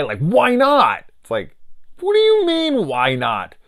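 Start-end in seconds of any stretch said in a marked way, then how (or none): 0.2-1.0 high, loud hammed-up protest; exaggerated squawk of disbelief; mock incredulous. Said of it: "the reaction of his". 2.0-3.5 high-pitched, loud comeback; hammed-up incredulous retort; mock disbelief. Acting out stunned reply to him.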